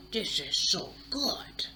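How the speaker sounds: slow, hushed whisper savoring the food; whispered relish. Retold or imitated. Guest eating the poisoned fish, slow whispered relish. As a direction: breathe it out slowly like the taste overwhelms you.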